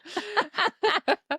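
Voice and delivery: high witchy cackle; cackling laugh. Real, not acted. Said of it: "Well, so do you now".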